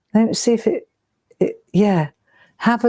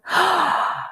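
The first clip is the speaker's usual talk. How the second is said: real gasp; 11 semitones higher than usual. Real, not acted. Finding a treasured old box.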